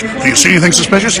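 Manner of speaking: quick, sneering imitation of a recorded announcement; mock announcer. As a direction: parody the lady on the speaker. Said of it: "Sure, let's listen to her".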